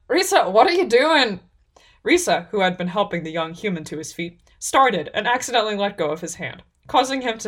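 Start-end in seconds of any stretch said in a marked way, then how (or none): 0.1-1.4 high, loud reproach; exasperated scolding. A narrator's reading. Half-orc catching the elf helping a stranger, exasperated. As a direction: break off into sharp disbelief.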